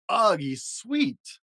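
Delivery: high, very slow disgusted drawl; grossed out by something cute. A narrator's reading. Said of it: "said the little monsters".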